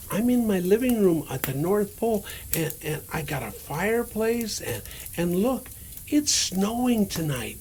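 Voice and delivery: plain reading voice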